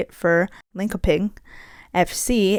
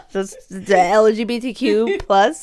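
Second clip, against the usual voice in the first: laughing; 3 semitones higher, 6 dB louder than usual. Real, not acted. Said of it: "laughing reveal".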